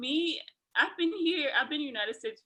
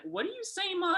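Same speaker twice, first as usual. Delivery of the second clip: puzzled questioning; 9 semitones higher than usual. Retold or imitated. Puzzled teammates asking Molly what she means, high-pitched mock confusion. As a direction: mimic someone who can't follow, pitched up.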